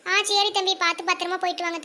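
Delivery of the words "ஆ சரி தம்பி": ordinary delivery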